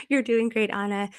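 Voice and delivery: normal talking voice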